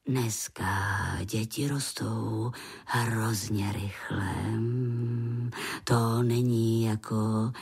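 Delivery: low, slow grandfather voice; old grandpa. A narrator's reading.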